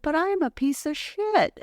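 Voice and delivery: high, lightly voiced drunk man putting himself down; retold drunk despair. Drunk stranger at the beach calling himself worthless, mild mock despair. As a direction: imitate his self-loathing, a hint of slur.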